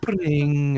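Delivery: high, loud sung "pling"; imitated sting sound. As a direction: mimic the music cue.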